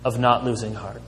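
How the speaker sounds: ordinary delivery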